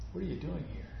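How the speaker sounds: hushed and soft; low whisper. Retold or imitated. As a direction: say it like a quiet inner voice. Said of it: "that low whisper".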